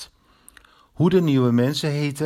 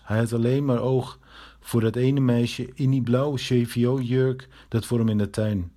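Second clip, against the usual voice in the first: plain reading voice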